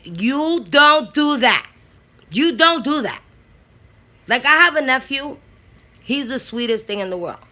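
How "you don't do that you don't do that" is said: slow, higher, firm warning; mock stern scolding; stern aunt. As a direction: lay down the rule. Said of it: "I'll be like".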